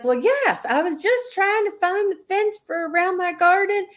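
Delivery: high, polite Southern mom voice; imitating her Southern mother patiently explaining, pitched high; Southern accent. Mom answering the drive-through speaker, in a high Southern accent. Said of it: "my mom's Southern".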